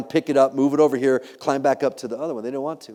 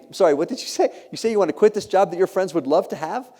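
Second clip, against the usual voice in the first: higher-pitched mock disbelief; incredulous spouse; 7 semitones higher than usual. New wife to husband who wants to quit, incredulous. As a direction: imitate a wife questioning him in disbelief.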